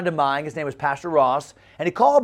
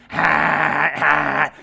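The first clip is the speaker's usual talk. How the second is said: two harsh imitated static bursts; seventh-grade boy's static; 3 semitones lower than usual. Shown as a demonstration. Mocking a seventh-grade boy's voice, static squawks. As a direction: mimic a breaking voice as radio noise. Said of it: "that's what a seventh grade boy voice sounds like".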